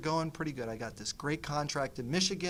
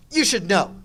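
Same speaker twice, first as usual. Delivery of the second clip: mock accusing wife; 5 semitones higher, 11 dB louder than usual. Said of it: "I will get two very bad answers".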